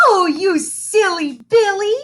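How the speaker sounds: loud, high, slow gushing coo; gushing teacher. Acted. Teacher coos at the big boy, loud and high. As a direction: overdo the sweetness like a doting teacher.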